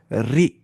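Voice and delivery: "री" with a fine, light R; Tajweed light ra on "री"; light thin R. Shown as a demonstration. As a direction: say "री" thinly, tongue forward, clear ee. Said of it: "तब हल्की आवाज़ से पढ़ेंगे".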